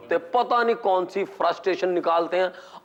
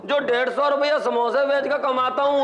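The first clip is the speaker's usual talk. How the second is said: samosa seller; 7 semitones higher than usual. Acted. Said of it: "समोसे बेचने वाला".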